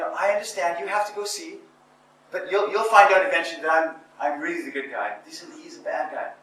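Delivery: high, imitating his own tearful pleading; mock crying plea. Young him at her door as she leaves, mock tearful plea. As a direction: plead through fake tears. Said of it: "And I'm crying and saying".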